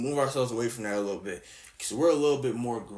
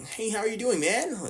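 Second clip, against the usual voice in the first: a higher, lightly mocked suburban "hey, how are you doing, man"; a hint of a corny suburban guy; corny suburban greeting; 9 semitones higher than usual. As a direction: play it as a cheesy, too-polite greeting. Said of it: "perceived as corny".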